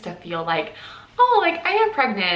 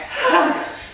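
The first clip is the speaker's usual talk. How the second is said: nervous giggle; 10 semitones higher, 3 dB louder than usual. Real, not acted. Nervous before test results.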